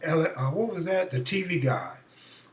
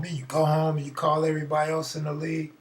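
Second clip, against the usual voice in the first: normal talking voice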